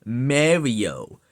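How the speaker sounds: MARIO said as MAIR-ee-oh, drawn out; wrong pronunciation. Retold or imitated. Imitating the YouTuber's habit, MAIR-ee-oh pronunciation. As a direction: stretch Mario with a "mare" first vowel. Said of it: "he says".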